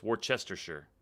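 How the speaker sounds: Worcestershire with a reduced "sher" ending; corrected pronunciation. Shown as a demonstration. Showing the learned way, Worcestershire ending in "sher". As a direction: end Worcestershire on "sher", never "shyer". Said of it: "I now know better".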